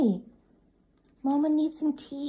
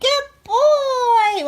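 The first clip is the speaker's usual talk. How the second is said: high, loud praise for the dog; excited praise; 9 semitones higher, 8 dB louder than usual. Real, not acted. Owner praising dog for a trick, loud and excited. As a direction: cheer him on.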